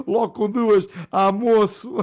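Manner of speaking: loud father. Acted. Father to son, loud and delighted. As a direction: shout it out with glee.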